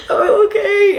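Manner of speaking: high, loud mock-crying squeak; imitated tearful voice. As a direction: wail it like a hammed-up sob. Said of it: "I was crying when she was telling me that".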